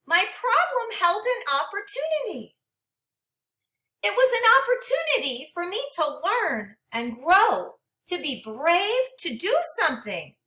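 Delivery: gentle, expressive storybook read-aloud; reading to children. Acted. Teacher reading a storybook to her class, expressive and gentle. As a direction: read it like a picture book for a class.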